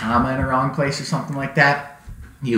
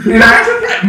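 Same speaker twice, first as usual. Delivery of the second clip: ordinary delivery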